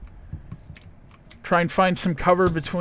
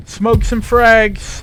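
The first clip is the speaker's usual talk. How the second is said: shouted order; 5 semitones higher, 6 dB louder, much slower than usual. Real, not acted. Squad leader calling orders.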